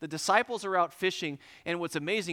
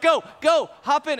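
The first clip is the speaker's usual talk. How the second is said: loud, high urgent shout; urgent command; 8 semitones higher, 9 dB louder than usual. Retold or imitated. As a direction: bark it to hurry the friend in. Urging driver switch at speed.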